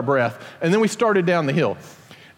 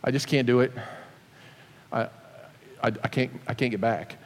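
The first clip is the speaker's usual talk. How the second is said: quivering voice; 5 semitones lower, 7 dB quieter than usual. Retold or imitated. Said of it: "with a little quiver in his voice".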